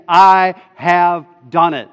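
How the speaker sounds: loud, slow declaration; emphatic proclamation. Acted. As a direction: hammer each word home slowly. Proclaims God's words.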